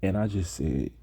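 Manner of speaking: ordinary delivery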